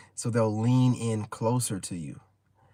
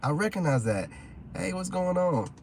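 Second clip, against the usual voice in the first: lightly raised eager greeting voice; a hint of an excited woman; 7 semitones higher than usual. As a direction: greet him like an eager fan. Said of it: "she's like".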